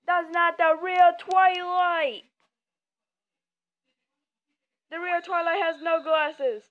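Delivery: loud, slow, high protest that it's the wrong one; indignant protest. Real, not acted. Gamer protesting the wrong Twilight on screen, loud and indignant.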